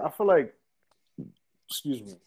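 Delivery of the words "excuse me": burp mid-sentence. Real, not acted. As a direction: talk through a burp. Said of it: "I'm burping like crazy".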